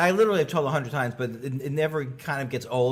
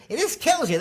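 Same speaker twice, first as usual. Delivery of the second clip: mock Leno whisper; 3 dB louder than usual. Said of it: "he whispers in my ear, goes".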